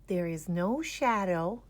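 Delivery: plain reading voice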